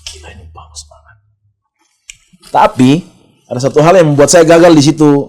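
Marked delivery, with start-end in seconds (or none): 0.0-1.2 whispered retold amazement; barely audible awed whisper; hushed awe. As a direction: marvel under your breath, as if to yourself. Retold private awe at song.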